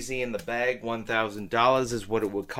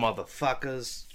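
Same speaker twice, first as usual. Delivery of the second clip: ordinary talk, nothing marked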